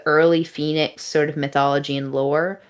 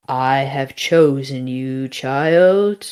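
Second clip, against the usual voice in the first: slow, lower godlike pronouncement; drawn-out mock-divine declaration; solemn voice; 4 semitones lower, much slower than usual. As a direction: intone it slowly to a mortal. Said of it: "she sort of goes back and forth with this voice".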